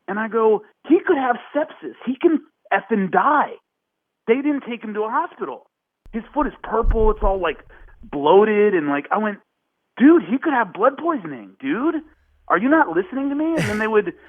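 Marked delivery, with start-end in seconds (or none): 0.9-3.5 alarmed warning. Retold or imitated. Warning producer about infection. 10.0-13.4 exasperated plea. Retold or imitated. Pleading with the producer.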